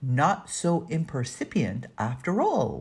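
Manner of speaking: usual speaking voice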